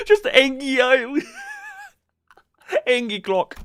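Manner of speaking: normal talking voice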